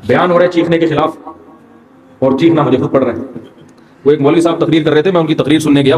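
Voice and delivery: usual speaking voice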